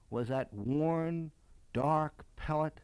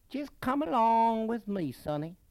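higher, louder Southern belle; Southern plantation lady; 7 semitones higher, 6 dB louder than usual. A narrator's reading. Lady ushering the boy into her house, Southern plantation accent. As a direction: sing out like a grand Southern hostess. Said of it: "the voice moving now from that of a witch to a great plantation lady".

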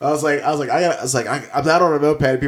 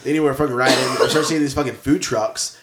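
usual speaking voice

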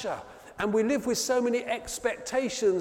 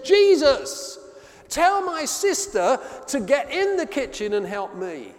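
higher and louder, fed-up complaint; exasperated outburst; 7 semitones higher, 6 dB louder than usual. Acted. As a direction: burst out at him in frustration. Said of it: "she boils and she bursts out of the kitchen".

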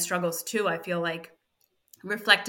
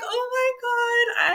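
much higher, slow, drawn-out gasp of emotion; overwhelmed oh my God; much slower than usual. Retold or imitated. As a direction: let it float up high and stretch, moved almost to tears. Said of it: "And I was just like".

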